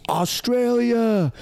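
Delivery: long drawn-out high scream of Australia. Retold or imitated. Drunk fan grabbing the mic, screaming at the crowd. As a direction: yell the country's name, stretched out long. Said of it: "and he screams".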